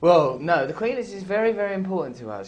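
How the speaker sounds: loud, high earnest-sounding declaration; mock patriotic insistence. Defending the Queen to the interviewer, loud mock earnestness.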